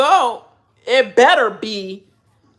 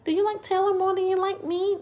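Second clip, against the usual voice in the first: high, softer voice begging for reassurance; mock an attention-seeker, pitched way up; needy girl; 10 semitones higher, 5 dB quieter than usual. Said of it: "that need attention like that".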